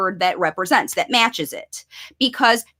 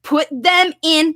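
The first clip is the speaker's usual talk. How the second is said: ordinary delivery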